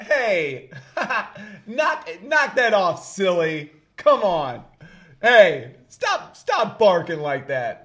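hammed-up neighbor scolding the dog; mock dog scolding. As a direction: play the neighbor half-laughing at the dog. Neighbors scolding their barking dog.

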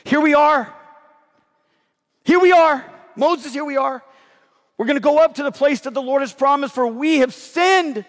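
high, loud desperate plea ending in a shout; desperate people's cry. Retold or imitated. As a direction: beg Moses to let them go up now. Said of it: "Notice what they say".

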